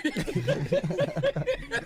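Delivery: high, soft wheezing laugh; helpless soft cackle; wheezy laugh. Real, not acted. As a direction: laugh quietly, high and breathless. Laughing at the friend's excuse.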